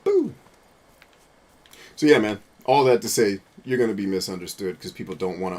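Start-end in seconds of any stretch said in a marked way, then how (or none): 0.0-0.3 high, sharp playful boo; jokey high boo; sudden boo. Real, not acted. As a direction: spook someone with a yelp. Jump-scare shout in game.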